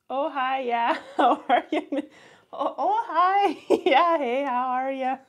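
high, chirpy mock-Minnesotan hello, laughing; playful Minnesotan impression breaking into laughter; Minnesotan accent. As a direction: greet them like an over-friendly Minnesotan, giggling.

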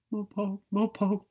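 high sung bird call; high hooting bird call from far off; nightjar call. A narrator's reading. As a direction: sing it like a distant night bird. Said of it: "sang the nightjar in the distance".

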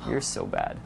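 low, soft mock accusation; hushed, playful scolding; teasing example. Shown as a demonstration. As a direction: tease softly, dropping to a whispered finish. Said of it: "here's a few other examples".

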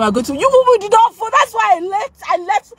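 high, fast, worked-up scolding; agitated scolding. Real, not acted. Streamer scolding viewers for not voting, high agitated rant. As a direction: scold the chat in a frantic rush. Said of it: "Okay, calm down".

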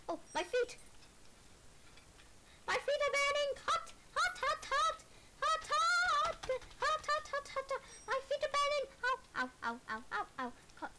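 high panicked yelps, feet burning; walking on hot sand. Pretend. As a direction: hop and shriek like the ground is scorching. Character's feet burning in game.

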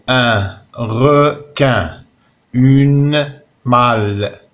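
each word spoken slowly, syllable by syllable. Shown as a demonstration. Teacher reading the word list slowly to pupils, syllable by syllable. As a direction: spell out the syllables at half speed. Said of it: "سطر على كاع الحروف".

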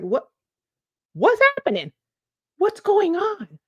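high-pitched amazed "what's going on?"; astonished disbelief. Retold or imitated. To viewers, recalling her week-three keto shock, astonished. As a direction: replay the moment it all clicked.

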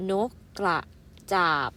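นกกระ then จาบ, split with a pause; syllable reading for young pupils. Shown as a demonstration. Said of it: "อ่านว่า".

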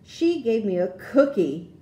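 plain reading voice

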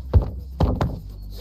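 sharp gasp of fright; startled gasp. Real, not acted. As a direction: gasp as if jumped at. Friend's sudden hello startles him.

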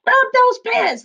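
high, loud roaring order; captain roars. A narrator's reading. Captain ordering pirates to chase, loud high roar. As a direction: bellow the order at the crew. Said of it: "the captain roars".

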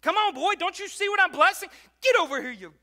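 put-on high and loud, a father hollering for his boy; impatient God voice. Preacher voicing God hollering impatiently for his boy, high and loud. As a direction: call him over, high and impatient. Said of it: "That's how I view God".

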